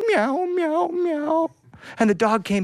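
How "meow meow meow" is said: high wailing meows; crying cat. Acted. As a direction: meow mournfully, way up high. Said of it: "And the cat said".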